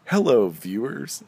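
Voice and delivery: loud, drawn-out presenter greeting; on-camera host. Acted. Podcaster greets an imagined camera, mock TV host voice. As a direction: hail an imaginary audience, big and slow. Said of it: "I always imagine that there's, like, a camera".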